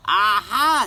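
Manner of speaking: loud high triumphant aha. A narrator's reading. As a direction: crow in triumph. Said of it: "said Big Anthony".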